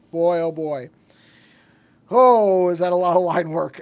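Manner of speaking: loud amazed exclamation; excited. Real, not acted. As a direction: marvel at it, loud. Exclaims at the amount of lines.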